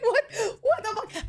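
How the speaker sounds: laughing shocked squeal; laughing disbelief. Retold or imitated.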